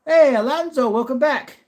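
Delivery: high, loud welcome-back call; excited greeting. Real, not acted. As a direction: greet the regular like a cheering host. Greeting a returning viewer.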